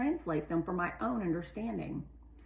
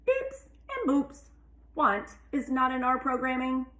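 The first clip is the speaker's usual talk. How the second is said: high, loud robot rover voice; other rover's voice; 6 semitones higher, 5 dB louder than usual. A narrator's reading. Rover Journey answering the narrating rover, high robotic voice.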